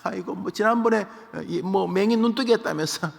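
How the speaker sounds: mock him with fake amazement; sneering mockery. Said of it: "비아냥".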